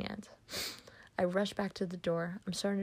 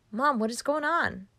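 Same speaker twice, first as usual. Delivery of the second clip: raised, higher voice, mildly panicked; alarmed question; 6 semitones higher, 6 dB louder than usual. Acted. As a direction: ask in alarm, louder and higher. Dreamer answers her yelling mother.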